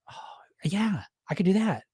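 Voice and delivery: soft breathy aside; sheepish whisper. Retold or imitated. Sheepish inner reply.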